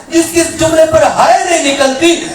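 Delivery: loud, half-sung majlis delivery; impassioned preaching. Acted. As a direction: preach it in a rising, chanting wail. Preaching at full force.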